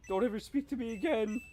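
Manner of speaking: put-on high anime girl pout; pouty anime girl line; cute anime girl voice. Anime voice demo.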